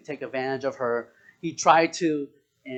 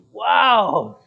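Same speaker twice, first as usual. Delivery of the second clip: big, loud exclamation of disbelief; loud, higher-pitched wow; loud amazement; 4 semitones higher, 8 dB louder than usual. Real, not acted. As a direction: shout it, astonished. Amazed so few saw the movie.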